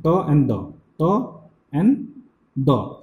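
"ta and da" with tongue tip on teeth, slow; dental demo. Shown as a demonstration. Teacher demonstrating dental sounds to students. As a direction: slowly model "ta and da". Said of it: "this particular sound".

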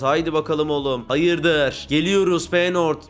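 usual speaking voice

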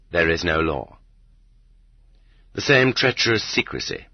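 ordinary delivery

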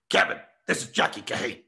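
put-on a near-whispered older comic's voice, unlike his own; Jackie Kahane impression. Retelling Jackie Kahane's phone call, a whispered impression. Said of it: "this is how he would talk".

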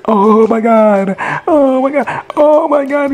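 building disbelief, slow and high; shocked gasp. Retold or imitated. Recapping the show, acting the son's shock.

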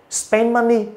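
"spend money" heard as "spen money"; no release of d before "money"; d dropped. Shown as a demonstration. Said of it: "người ta nói nhanh nên cái âm này nó bị mất đi".

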